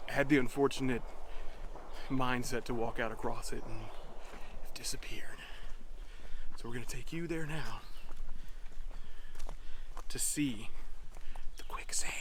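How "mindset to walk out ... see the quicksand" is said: hushed spooky whisper. Acted. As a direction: whisper it like a campfire tale. Spooky buildup to the quicksand.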